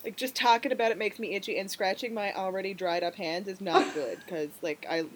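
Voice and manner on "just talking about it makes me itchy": ordinary talk, nothing marked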